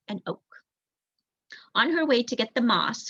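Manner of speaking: ordinary delivery, nothing marked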